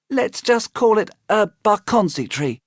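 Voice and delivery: put-on high, puzzled little girl; a child asking in a high voice; high girl's voice. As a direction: squeak it out puzzled. May voiced.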